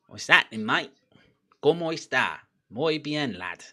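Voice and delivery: jokey Spaniard with Cockney vowels; Cockney Spanish accent. Acted. Mimicking Bellerin greeting a mate, Cockney-Spanish accent. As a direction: mix Cockney and Spanish for laughs. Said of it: "bro, the Cockney Spaniard".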